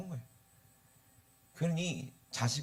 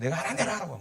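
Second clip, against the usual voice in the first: higher-pitched mock insistence; retold stubborn insistence; 6 semitones higher, 3 dB louder, faster than usual. Son overruling the carpenter about the pulpit, mock stubborn. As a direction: retell digging your heels in, pitch lifted. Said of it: "고집을 피워서 이렇게 했어요".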